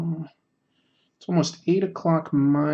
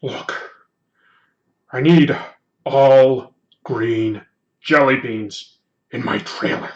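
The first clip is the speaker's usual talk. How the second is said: slow, halting film-star impression with loud bursts; Harrison Ford; 6 dB louder, slower than usual. Shown as a demonstration. Streamer doing a requested Harrison Ford, slow impression. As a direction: punch out each word slowly, gruff actor parody. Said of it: "I have to do Harrison Ford".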